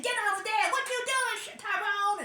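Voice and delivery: high-pitched mock yell at the kids; mock yelling mom. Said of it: "yelling at her kids".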